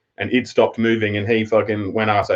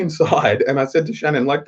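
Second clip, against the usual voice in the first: ordinary talk, nothing marked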